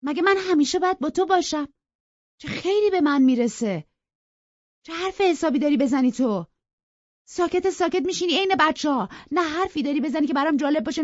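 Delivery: high, loud, fed-up rant; exasperated girl berating her friend. A narrator's reading. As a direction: let the frustration spill over. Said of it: "کلافه تر و ناراحت تر گفت".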